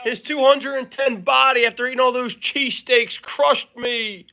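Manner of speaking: high, mock-pained cry for help; imitated whining victim. Co-host crying to Miss Mangini after being crushed, high mock wail. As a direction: wail like a flattened wrestler, hammed up. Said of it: "and you would say".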